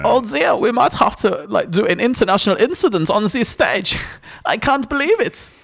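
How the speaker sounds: high, put-on German-accented host; comic flustered host with German accent; imitated show hosts. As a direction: do a hammy Germanic TV presenter. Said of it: "the hosts go".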